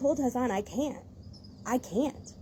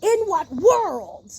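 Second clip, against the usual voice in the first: outraged disbelief; 7 semitones higher, 7 dB louder, slower than usual. Real, not acted. Outraged at kittens being hidden.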